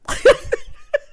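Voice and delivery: high-pitched burst of laughter. Real, not acted. Recapper laughing at his casino job claim, high laugh.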